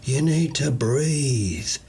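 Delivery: very slow, soothing; slow it right down to calm it. Acted. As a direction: handyman settling the plant, very slow and soothing. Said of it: "voice calm, almost soothing".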